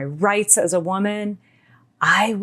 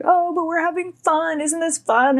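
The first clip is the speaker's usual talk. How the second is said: high, wheedling mock of a pushy guy; mock-cheery coaxing, pitched high; coaxing man; 11 semitones higher than usual. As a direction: imitate him pleading sweetly, pitched well up. Retold man coaxing her to stay.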